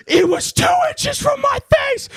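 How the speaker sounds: high loud terrified shriek; panicked yell. Retold or imitated. Boy telling his mom about the spider, shrieking. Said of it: "And I was like".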